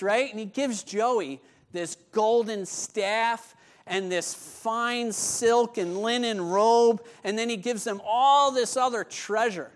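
ordinary delivery

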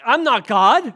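mock the host's evasive reply; mocking quote. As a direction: sneer it back as a lame excuse. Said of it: "They go".

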